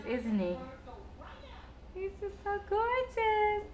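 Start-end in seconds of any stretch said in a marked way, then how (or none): 1.9-3.6 high, soft, slow adoring coo. Real, not acted. Mother gushing over her son, soft high coo. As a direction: melt over him slowly.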